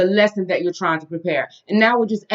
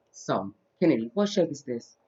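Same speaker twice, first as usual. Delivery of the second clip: put-on soft, slow voice for a small child; reading to a toddler; 3 semitones lower, 12 dB quieter, slower than usual. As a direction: count gently with a toddler. Teaching toddler shapes.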